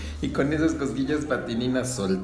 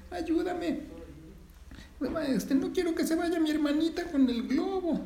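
frightened plea. Acted. Frightened sister calls for help.

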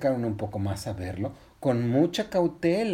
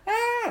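short high-pitched scream; brief storybook shriek; acted scream. A narrator's reading. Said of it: "los dos gritaron".

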